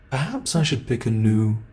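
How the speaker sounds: normal talking voice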